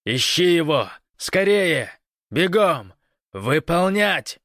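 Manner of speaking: high, loud military orders; commanding shout. A narrator's reading. Гош orders Большой to run, shouting in command. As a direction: shout each order, stretching the last. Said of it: "прочувствовав командную интонацию".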